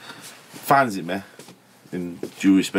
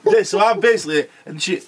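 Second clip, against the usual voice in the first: usual speaking voice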